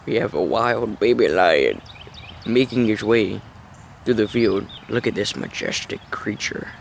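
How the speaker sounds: low, hushed wildlife narrator voice; nature documentary. Acted. Mock wildlife film intro.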